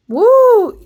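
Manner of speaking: sudden high whoop of excitement; excited woo. Real, not acted. Vlogger whooping at her own cosmic insight, high and loud.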